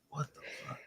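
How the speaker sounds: a breathy, voiceless mock "what the"; whispered disbelief. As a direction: mutter it under your breath in disbelief. Retelling reaction to buyer's taste.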